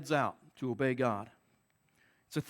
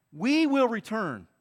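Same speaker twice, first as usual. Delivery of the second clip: "We" pressed, slow and higher; stress on we; 4 semitones higher, 3 dB louder, slower than usual. Shown as a demonstration. Preacher stressing "We" to the congregation, slow and pressing. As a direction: lean on "We" in "We will return", said slowly. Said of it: "I want to draw your attention to the word "we".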